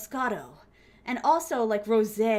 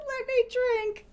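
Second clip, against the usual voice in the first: high whiny begging cry; whiny plea; 12 semitones higher, slower than usual. Retold or imitated. Retelling begging friends to drink more, high whiny plea. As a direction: beg like a sulky kid. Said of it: "I'm like".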